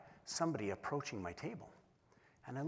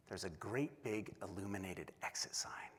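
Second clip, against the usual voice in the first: hushed, conspiratorial whisper; whispered reveal; 4 semitones lower, 9 dB quieter than usual. Retold or imitated. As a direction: let the audience in on a secret. Spotting the exit in secret.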